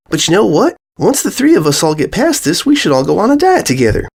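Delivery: a filly answering her friend; Apple Bloom voice. A narrator's reading. Filly to her friends, lightly voiced.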